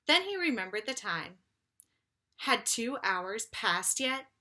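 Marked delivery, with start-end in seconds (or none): none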